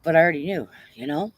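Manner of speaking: ordinary delivery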